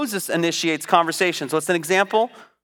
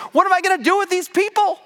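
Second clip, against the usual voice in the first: high, fast exasperated cry; 12 semitones higher, faster than usual. Retold or imitated. Moses crying out to God over his people, high and fast. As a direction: toss it up high and quick in despair. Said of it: "where Moses cries out".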